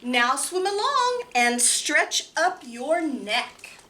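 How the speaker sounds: lively picture-book reading with big pitch swings; reading to children. Acted. Librarian reading Hooray for Birds to children, lively and playful. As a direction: read it bouncy and playful for small kids.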